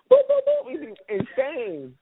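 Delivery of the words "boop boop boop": high, loud repeated boops; mock punch sounds. Pretending to beat someone up, high cartoon punch noises. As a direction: pop out cartoon hit noises. Miming punches in imagined fight.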